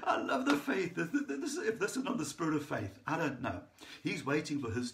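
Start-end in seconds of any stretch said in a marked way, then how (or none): 0.0-2.0 high-pitched, laughing as you speak; laughing delight. Real, not acted. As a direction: talk through a delighted laugh. Laughing at his own retold story.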